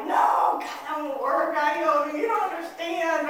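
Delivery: loud, high, drawn-out protest; acted wail of frustration; exasperated teen outburst. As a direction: throw the advice back like an overwrought teenager. Acting out complaining daughter's outburst.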